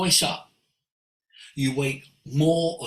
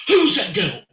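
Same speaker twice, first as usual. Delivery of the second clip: loud, raised-pitch insistent question; astonished demand; 5 semitones higher, 8 dB louder than usual. Retold or imitated. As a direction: blurt it out as the awestruck friend. Acts friend's astonished question repeated.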